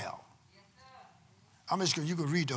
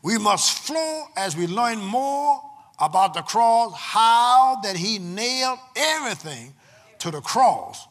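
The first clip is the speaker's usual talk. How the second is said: loud, emphatic preacher with long-held key words; full-force preaching; 3 semitones higher, 9 dB louder than usual. Real, not acted. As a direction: preach it to the congregation in bursts, punching the key words.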